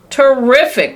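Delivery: high, loud delight; cried out. A narrator's reading. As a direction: burst out with joy. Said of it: "cried Grandpa Joe".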